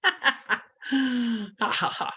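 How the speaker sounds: high cry then breathy evil cackle; evil laugh. Acted. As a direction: cackle like a cartoon villain. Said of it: "Should use my evil voice".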